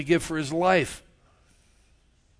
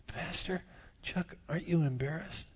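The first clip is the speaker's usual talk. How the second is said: a frail little lady's hushed question; elderly lady; 8 dB quieter than usual. Retold or imitated. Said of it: "and she said".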